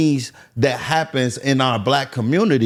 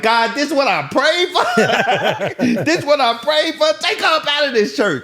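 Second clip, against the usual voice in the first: very high, incredulous mock cry to God; hammed-up pleading prayer. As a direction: wail a comic complaint to heaven. Joke acting out childhood prayer.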